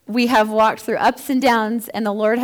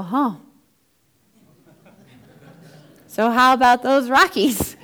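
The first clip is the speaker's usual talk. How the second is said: awkward change of subject; 2 semitones higher, 5 dB louder than usual. Retold or imitated. Mimicking awkward friends changing subject.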